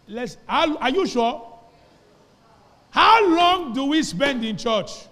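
loud, slow, ringing scripture reading; big pulpit voice, drawn out and loud; preaching at full force. Acted. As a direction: proclaim every word to the whole church, slowly.

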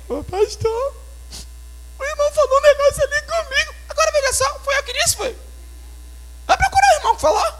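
complaining church member. Retold or imitated. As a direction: squeak it up like someone running to the pastor. (voz bem aguda e chorosa de membro reclamando ao pastor)

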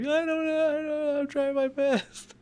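mock crying, very high-pitched whine; crying Kevin Hart. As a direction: wail it in a put-on high tearful voice. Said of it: "he's, like, crying".

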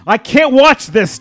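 very loud, high, slow yell. Acted. Tobias watches friends swallowed, very loud anguished yell. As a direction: shout it out in anguish. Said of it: "Tobias yelled".

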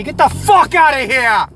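high, loud outraged shout; shrill furious cashier yelling; angry cashier yell. Acted. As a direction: throw him out of the store. Cashier angrily yelling back.

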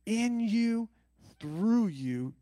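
"in you" called out loud and high; rising preacher shout. Acted. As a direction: belt it to the room. Preaching the Spirit flows.

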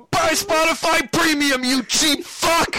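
high, loud, slow megaphone shout; through a megaphone. Retold or imitated. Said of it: "He puts on the megaphone".

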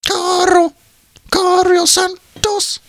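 very high, drawn-out cartoon voice; American Dad imitation. Riffing on a cut player's name, American Dad imitation. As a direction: squeal the name slowly, like a cartoon bit. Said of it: "American Dad reference".

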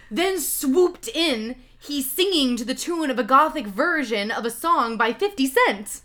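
high, loud theatrical narration; dramatic story reading. Acted. Reading bad fanfiction to a friend, dramatically.

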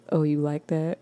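low, soft attempt at sexy, landing stern; pissed off principal, unintended. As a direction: try sexy but come out like a cross principal. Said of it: "sound like a pissed off principal".

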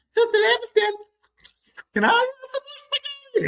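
put-on very high, pleading little-boy voice; whiny begging kid. Foster brother begging mom for spaghetti in a high whiny kid voice. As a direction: beg sweetly like a spoiled kid.

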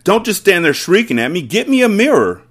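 high, loud, furious order; father's frantic shouted demand; yelling. A narrator's reading. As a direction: yell impatiently at the wife. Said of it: "the father yelled".

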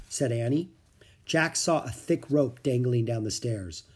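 usual speaking voice

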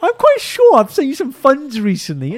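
high, slow, prim politeness; over-polite to hide irritation; overly polite. Retold or imitated. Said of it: "he's so polite as well".

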